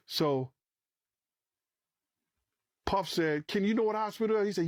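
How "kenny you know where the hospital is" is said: fast, higher-pitched asking; retold urgent question. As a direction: reenact a rushed question to the driver. Retold urgent question after shooting.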